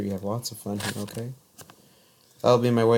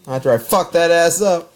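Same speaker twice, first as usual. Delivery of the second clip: higher and louder mock threat; hammed-up yell; 8 semitones higher, 10 dB louder than usual. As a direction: shout it over the top for laughs. Joking escalation, voice up.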